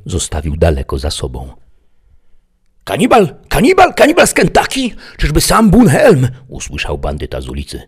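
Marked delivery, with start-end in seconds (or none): 2.8-6.3 very high, loud repeated call. A narrator's reading. Man outside calls to the bandit, high yelling. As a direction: yell the name from the street. Someone yells from the street.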